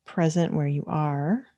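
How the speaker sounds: unhurried meditation guidance with long pauses; calm, measured mindfulness teacher leading a practice; guided meditation. Acted. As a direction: guide listeners gently into stillness, leaving space between phrases.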